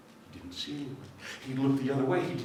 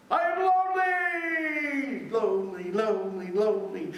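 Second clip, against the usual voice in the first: echoing lonely shout; 7 semitones higher, 4 dB louder than usual. Acted. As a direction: cry out, then echo fading. Echo of lonely call.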